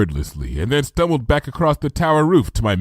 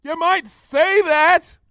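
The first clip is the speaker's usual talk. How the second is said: high slow shout, dry; slow high shouted retort; yelling; 3 dB louder, much slower than usual. A narrator's reading. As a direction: yell back sarcastically. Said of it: "I yelled".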